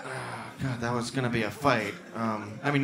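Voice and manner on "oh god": ordinary delivery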